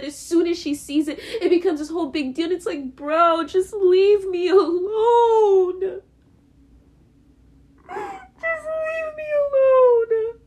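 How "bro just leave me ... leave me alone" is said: slow, high crying wail, voice cracking; sobbing plea. Real, not acted. Begging his mother to leave him alone, sobbing. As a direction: beg through real tears, drawn out.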